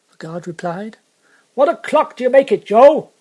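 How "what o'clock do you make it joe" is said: loud, high shouted question; calling out. Acted. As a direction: coachman hollering back to the guard. Coachman calls question to guard.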